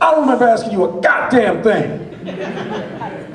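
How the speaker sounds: loud, high, furious movie-hitman impression; Samuel L. Jackson. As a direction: thunder it slowly at someone interrupting you. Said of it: "turns around and is like".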